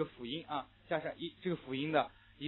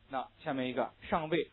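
ordinary delivery

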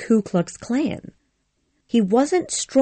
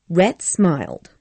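usual speaking voice

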